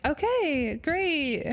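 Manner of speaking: high, sing-song mock cheer; sarcastic okay. Wife answering her husband's lie, high and sarcastic. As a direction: fake brightness masking hurt. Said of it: "she's like".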